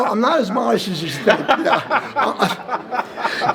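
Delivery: laughing while joking, voice lifted high. Real, not acted. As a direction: chuckle through the boast. Joking about running back modesty.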